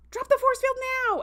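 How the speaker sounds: high, loud acted order; urgent shouted command. Acts out Sam's urgent command.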